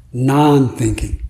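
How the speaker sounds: slow, deliberate "Non-thinking"; calm master's answer. Retold or imitated. Yao Shan answering the monk, slow and deliberate. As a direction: let it land slowly like a master. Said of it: "And Yao Shan says".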